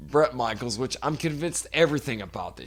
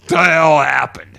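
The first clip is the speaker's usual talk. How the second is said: loud, high, slow anguished wail of a phrase; crying outburst; 5 semitones higher, 12 dB louder than usual. Retold or imitated. Imitating Bret cracking on camera, loud slow wail. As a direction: howl it like a man cracking. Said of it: "That's why we're calling this podcast".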